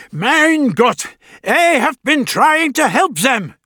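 put-on high German-accented old man. A narrator's reading. German goldsmith pleading.

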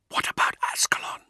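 unvoiced urgent whisper; whispered question. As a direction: whisper it anxiously. Whispered question near sentry.

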